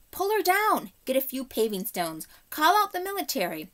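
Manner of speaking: high, loud crowd shouting; angry roar. A narrator's reading. Angry crowd roaring at the witch, loud angry shout. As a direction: bellow it like an angry mob. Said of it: "an angry roar from the crowd".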